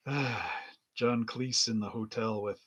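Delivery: ordinary delivery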